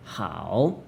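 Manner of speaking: "hao" soft, low, dipping then rising; teacher tone. Shown as a demonstration. Teacher demonstrating the word good, "hao" dipping then rising. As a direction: scoop "hao" down and up. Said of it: "also teacher tone".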